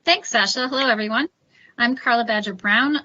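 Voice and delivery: normal talking voice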